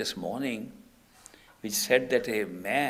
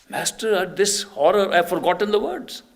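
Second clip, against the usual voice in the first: loud, high-pitched distress; retold panic, raised voice; acted desperate plea; 7 semitones higher, 9 dB louder than usual. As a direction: cry out helplessly to the master. Disciple cries out in horror.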